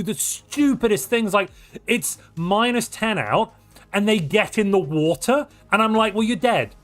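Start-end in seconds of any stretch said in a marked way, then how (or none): none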